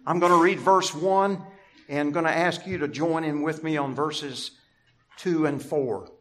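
ordinary talk

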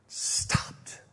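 slow, hushed whisper; breathy awed whisper of stillness. Acted. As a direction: let the hush fall after the storm. Sudden calm after rebuke.

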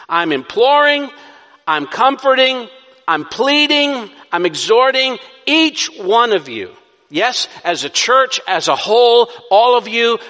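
high, loud, pleading preacher's cry; preaching at full force. Acted. Preacher pleads with his congregation at full force. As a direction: build the plea with rising urgency.

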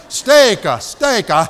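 talking loud and simple to a foreign waiter; loud broken tourist speech. Retold or imitated. To the waiter, ordering steak in loud broken words. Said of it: "I said it like that".

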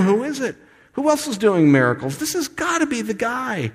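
high-pitched, eager acted amazement; excited onlookers. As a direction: play a crowd convinced they've found the one. Voicing excited followers' reaction.

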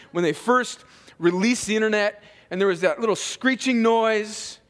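mock outrage sinking into mock lament; sarcastic. Real, not acted. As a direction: play up trivial hardships as if they were tragic. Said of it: "I'm being sarcastic, obviously".

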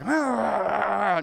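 drawn-out frustrated "aah" growl; mock-angry roar, held back from cursing. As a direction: let out a loud exasperated growl. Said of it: "I'm getting angry. I'm just like".

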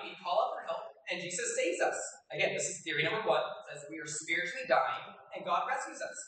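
usual speaking voice

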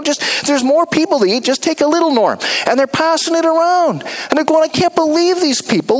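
high, fed-up mock grumble; impatient grumbling. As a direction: hurry the crowd along, annoyed. Grumbling disciples acted out.

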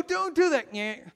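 high, loud child's protest with a mock nyeh; whining kid. Little brother pleading with his brother, high and loud. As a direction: beg him to stop, then taunt back. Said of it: "And I go".

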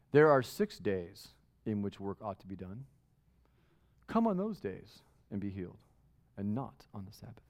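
put-on lofty old official lecturing the crowd, low and hushed; pompous synagogue ruler. Preacher voicing the indignant ruler, low prim scolding. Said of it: "You can just hear him in his little robe and his little hat".

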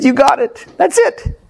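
high-pitched, eager retold confirmation; excited agreement. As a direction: leap up high, as if he finally understands. Excited reply to dying father, retold.

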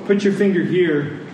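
usual speaking voice

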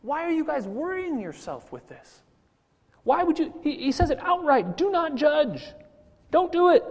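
high, insistent paraphrase of Jesus; voicing Jesus. Preacher voices Jesus telling the crowd not to judge, high and insistent. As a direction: speak as Jesus telling them to stop judging. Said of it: "See, Jesus says".

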